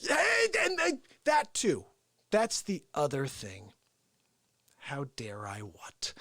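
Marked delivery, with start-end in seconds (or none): 4.8-6.1 breathy whisper, slow and very soft. Acted. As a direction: hiss it under the breath. Whispered then repeated aloud.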